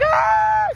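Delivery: high short shout; teacher imitating a girl shrieking as her chair is moved; mock kid scream. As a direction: let out a child's shriek.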